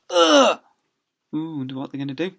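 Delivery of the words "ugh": high comic ugh; cartoon grunt; grunt of pain. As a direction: grunt as you hit the ground. Children knocked out of way.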